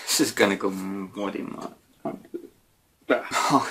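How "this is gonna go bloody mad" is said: ordinary delivery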